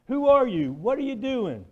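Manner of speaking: high, loud furious yell; a man shouting at the boy who hit his car; retold shout. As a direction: bellow it at the kid who threw the brick. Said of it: "and shouted".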